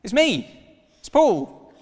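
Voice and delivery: loud, high, eager shout; calling out. Retold or imitated. Fan calling out to celebrity from queue, loud and eager. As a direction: wave him down, desperate to be known.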